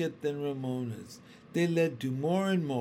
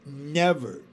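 ordinary delivery, nothing marked

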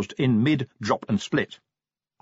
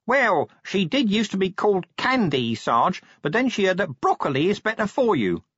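matter-of-fact, pitched up; chatty Nobby; 10 semitones higher than usual. Acted. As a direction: reassure your sergeant in a perky common voice.

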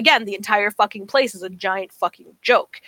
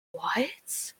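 unvoiced stunned whisper; whispered what; 13 dB quieter than usual. Reacting to guidance saying teachers decide, whispering. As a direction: breathe it out in disbelief.

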